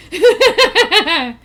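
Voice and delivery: short loud burst of laughter. Real, not acted. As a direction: laugh hard at the joke. Laughing at Discord post.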